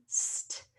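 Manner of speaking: "st" as bare hissed s into t; blended sound. Shown as a demonstration. Teacher showing that both consonants are heard, sounded out. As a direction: sound out "st" with no vowel. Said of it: "so that's a blend".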